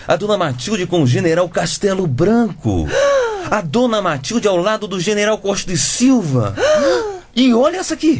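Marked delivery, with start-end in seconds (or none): 2.9-3.5 short high gasp of shock; startled high intake of breath; sharp gasp. Acted. Gasp at grandma photos. 6.6-7.3 short high gasp of shock; startled high intake of breath; sharp gasp. Acted. Gasp at another photo.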